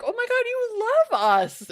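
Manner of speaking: very high, loud mock gush; gushing imitation. Imitating one lady answering American admirers, gushing. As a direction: squeal it like a delighted fan.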